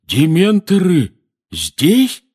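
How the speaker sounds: loud, slow, rising question; high, drawn-out disbelief; horrified. A narrator's reading. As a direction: ask it again aghast. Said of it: "в ужасе переспросил наземникус".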